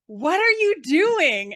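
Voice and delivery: high, loud and slow, a shocked yell; shocked cry. Retold or imitated. Woman catching her date pantless, shocked yell. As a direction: recoil in disbelief at what you see.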